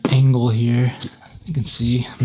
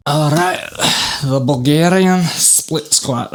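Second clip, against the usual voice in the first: put-on slow, higher playful Scottish accent; 5 semitones higher, slower than usual. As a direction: announce the exercise in a hammed-up Scottish accent. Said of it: "That is a Scottish accent".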